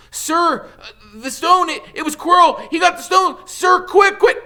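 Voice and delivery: put-on high, loud, breathless boy's voice; panicked Harry. Harry wakes and urgently warns Dumbledore, high and panicked. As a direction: blurt it out in a rush of alarm.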